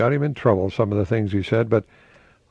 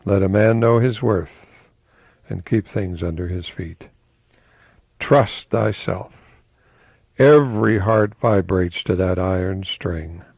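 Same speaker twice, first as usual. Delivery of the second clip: slow, weighty recitation; quote reading; 3 semitones lower, slower than usual. Acted. Host recapping Emerson quotes, slow declamation. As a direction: summarise the maxims gravely.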